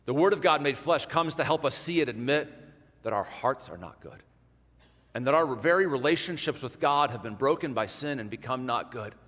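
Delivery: usual speaking voice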